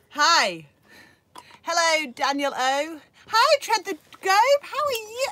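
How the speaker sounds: high, loud, slow gushing hellos; bursting with delight at the viewers; excited greeting. Real, not acted. Vlogger greeting viewers on a livestream, excited. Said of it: "I got excited".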